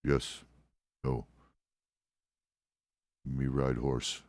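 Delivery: low, terse one-word answers; lightly voiced character of few words, pitched low; low, halting broken English; broken-English role. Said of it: "uses broken English and uses, like, simple one-word answers".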